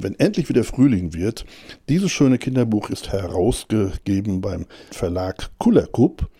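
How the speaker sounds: plain reading voice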